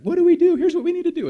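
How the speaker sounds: put-on very high, loud kid yell; squealing mock panic at full volume; frantic child. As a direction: imitate a six-year-old shouting advice downstairs. Six-year-old shouting from top of stairs.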